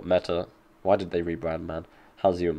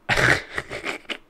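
airless, voiceless laugh from a sick throat; sick wheezy laugh. Real, not acted. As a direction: laugh while ill, barely voiced. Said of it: "Oh god, that laugh. It's because I'm ill".

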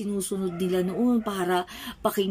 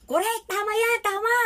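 high, eager voice of the cheering crowd; excited crowd cheer; 11 semitones higher, faster than usual. Acted. Preacher voicing the crowd agreeing eagerly, high and excited.

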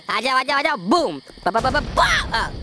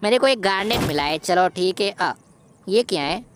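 ordinary talk, nothing marked

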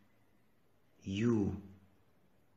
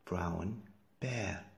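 ordinary talk, nothing marked

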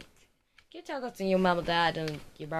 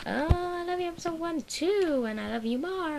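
mock mum voice; 11 semitones higher, 4 dB louder than usual. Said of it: "your mama will say".

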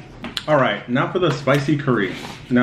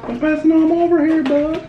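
high squeaky voice; 3 dB louder than usual. Pretend. Dad teasing child, squeaky voice.

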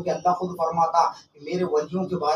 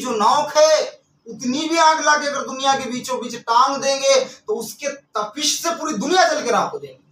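raised voice, drawn-out weighty phrases; fire-and-brimstone preaching; 6 semitones higher, 6 dB louder than usual. Real, not acted. Preacher citing hadith on the heat of hell, fervent. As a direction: stretch out the dread of hellfire.

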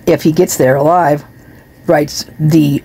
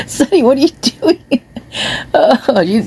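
much higher cooing pet voice; talking to the cat; 8 semitones higher than usual. Real, not acted. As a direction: ask the cat fondly.

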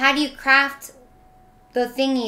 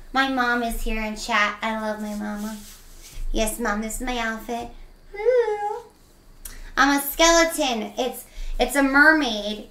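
usual speaking voice

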